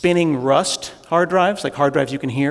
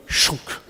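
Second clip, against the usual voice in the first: sword slice noise; 4 dB quieter than usual. Retold or imitated. Ninja sword sound effect.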